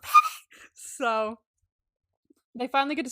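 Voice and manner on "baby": shrieked far higher and louder, thrilled; squealing. Retold or imitated. Fangirling over the phone scene, excited squeal. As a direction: squeal it like melting with joy. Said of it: "I was like".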